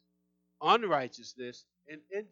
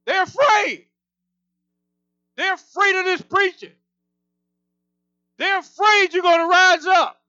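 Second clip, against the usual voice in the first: much louder and higher, full-force preaching; intense preaching yell; 8 semitones higher, 9 dB louder than usual. Real, not acted. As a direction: preach it at the top of your lungs, rousing the church. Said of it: "Guys, I get intense".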